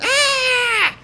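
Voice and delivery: high, loud comic shriek; high-pitched "Ah!" of panic; mock running scream. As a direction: scream like a fleeing cartoon mom. Mother runs away screaming in story.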